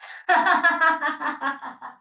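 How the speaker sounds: loud, high laugh falling away; hearty laugh. Shown as a demonstration. Teacher's final laugh in the milkshake exercise. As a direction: let the laugh roll out. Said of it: "So again".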